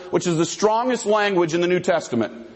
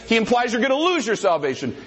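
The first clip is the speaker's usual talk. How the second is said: usual speaking voice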